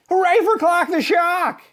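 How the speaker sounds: high, loud cheer; group cheer. A narrator's reading. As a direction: cheer for the hero. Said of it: "everyone cheered".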